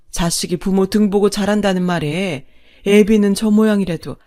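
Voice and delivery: loud, bitter tirade of a long-suffering wife; finally unleash years of held-back anger; mother-in-law tearing into her unfaithful husband; furious scolding. Acted.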